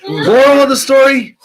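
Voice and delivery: shouted, high and laughing; loud declaration. Real, not acted. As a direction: announce it loud through laughter. Declaring after hot sauce.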